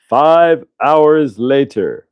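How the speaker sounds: very slow, drawn-out cartoon narrator; SpongeBob time-card narrator. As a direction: stretch each word like a time-skip announcer. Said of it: "like, like, um, SpongeBob, right?".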